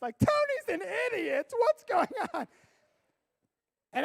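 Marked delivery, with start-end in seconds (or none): 0.2-2.5 high mocking kid voice, louder; kids texting parents. Students texting parents about their leader, mock high voice. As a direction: imitate panicked students gossiping. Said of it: "They're probably texting their parents".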